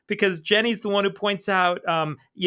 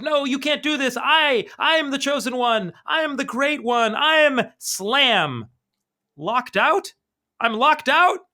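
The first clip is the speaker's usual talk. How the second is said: raging villain; 6 semitones higher than usual. Retold or imitated. Clockman shut out of the door, pompous rage. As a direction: boast then disbelief.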